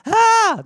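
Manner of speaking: high loud shriek of a startled pet; pet squeal. Retold or imitated. Cat stepped on by owner, high loud shriek. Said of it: "they're like".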